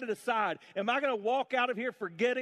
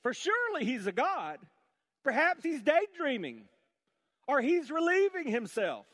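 high, sarcastic mockery; scoffing; 6 semitones higher, 3 dB louder than usual. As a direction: needle them with fake sympathy. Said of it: "he scoffed".

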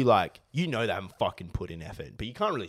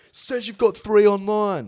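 higher, slower whiny customer voice; mocking customer; 10 semitones higher, 7 dB louder, slower than usual. Mimicking annoying customers to a friend, whiny. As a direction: drag it out like an entitled shopper. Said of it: "customers would be like".

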